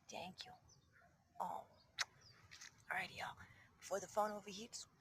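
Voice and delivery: low, hushed thanks; soft whisper. Real, not acted. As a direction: keep it to a whisper. Whispering while groomed.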